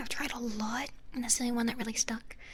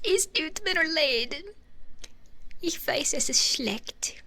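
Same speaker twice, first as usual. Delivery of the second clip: mock German apology; 4 semitones higher, 4 dB louder, slower than usual. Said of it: "That accent is serious".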